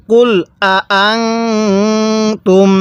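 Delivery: আং held as a nasal hum before তুম; ghunnah nasal hold. Shown as a demonstration. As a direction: hum the noon through the nose. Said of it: "এই নুন সাকিনের মধ্যে আমরা গুন্নাহ করব".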